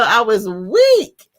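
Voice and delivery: plain reading voice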